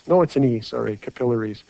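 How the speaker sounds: ordinary talk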